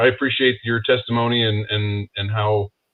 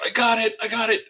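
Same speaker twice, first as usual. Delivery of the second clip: much higher, excited blurt of discovery; 11 semitones higher than usual. Retold or imitated. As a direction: burst out with sudden triumph. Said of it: "usually I'll blurt it out".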